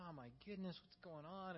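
a soft, worried murmur; quiet dismay over a struggling son; hushed dismay. Retold or imitated. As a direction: breathe out quiet distress. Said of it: "you're just like".

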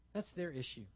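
normal talking voice

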